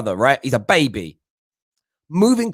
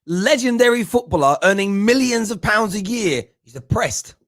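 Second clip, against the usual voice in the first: ordinary talk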